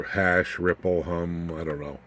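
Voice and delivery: usual speaking voice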